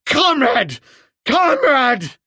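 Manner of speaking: high, loud piercing scream of surrender; high, loud, desperate screaming. A narrator's reading. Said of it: "in a piercing voice, screamed".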